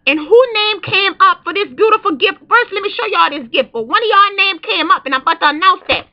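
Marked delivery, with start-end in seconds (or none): none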